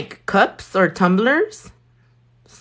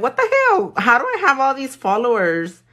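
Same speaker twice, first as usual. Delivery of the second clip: mock disbelief; 7 semitones higher, 3 dB louder than usual. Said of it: "'Cause I'm like".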